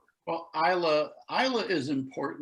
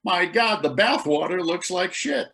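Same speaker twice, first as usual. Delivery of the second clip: raised-pitch retelling of appalled reaction; mock horrified; 6 semitones higher than usual. American visitor on first trip to Islay, mock horrified. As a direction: exclaim it, imitating a horrified tourist. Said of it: "I was horrified being the ugly American".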